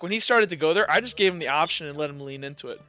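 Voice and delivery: normal talking voice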